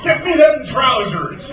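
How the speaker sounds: loud, high, drawn-out bellow; bellowing pirate. A narrator's reading. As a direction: bellow it slowly like a pirate captain. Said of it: "he bellowed".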